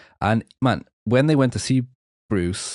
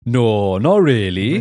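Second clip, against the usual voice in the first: exaggerated Welsh accent; 3 semitones higher, 6 dB louder, much slower than usual. Echoing the Welsh audiologist from the audiobook, hammed-up Welsh accent. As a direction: lay the Welsh lilt on thick, mocking it. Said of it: "a really exaggerated Welsh accent".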